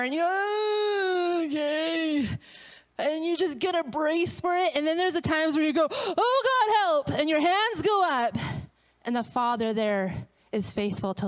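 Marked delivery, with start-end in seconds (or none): 0.3-2.3 high, long-held oh, sliding down; nervous reluctance. Retold or imitated. Reluctantly agreeing to go deeper. 5.9-7.2 high mock cry for help; panicked plea. Crying out to God in waves.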